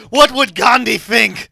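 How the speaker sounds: loud mock-offended scolding through laughs; mock outrage. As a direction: play the scandalized defender.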